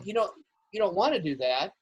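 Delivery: ordinary talk, nothing marked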